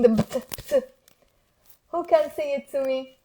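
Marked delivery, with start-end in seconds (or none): 0.1-0.8 "bt, bt" as quick bursts, B straight into T; isolated "bt" sound, repeated; consonant cluster demo. Shown as a demonstration. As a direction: pop out "bt, bt" without a full vowel. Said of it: "the trick about pronouncing".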